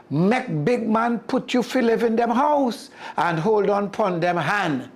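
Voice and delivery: sly Anansi. Acted. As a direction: coax him like a smooth con man. Anansi sells his scheme.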